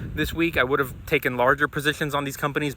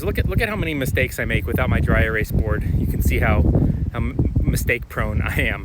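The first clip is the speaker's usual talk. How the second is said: normal talking voice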